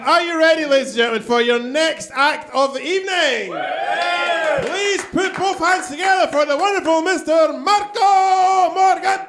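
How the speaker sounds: high, shouted announcer build-up; hammed-up MC shout for the next act; announcer intro.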